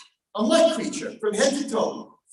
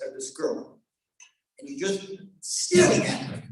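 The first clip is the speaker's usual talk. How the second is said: ordinary talk, nothing marked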